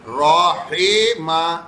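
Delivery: "راحمہ" slowly as "raa-hay-ma"; slow "راحمہ" with "hay" in the middle; wrong majhool vowel. Shown as a demonstration. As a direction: show the mistake in "راحمہ": a drawn-out "hay". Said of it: "نہیں پڑھیں گے".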